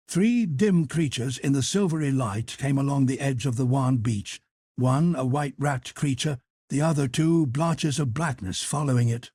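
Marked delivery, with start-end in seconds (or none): none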